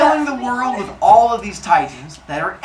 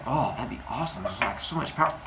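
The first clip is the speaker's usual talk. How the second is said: dim son; 8 semitones lower, 14 dB quieter, faster than usual. Acted. Voicing eager son Cronus.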